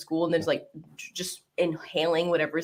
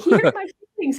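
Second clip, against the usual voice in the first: high, louder whiny mock-pout; mock hurt whine; 9 semitones higher, 6 dB louder than usual. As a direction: whine it like a wounded kid, half-joking. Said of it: "I was like".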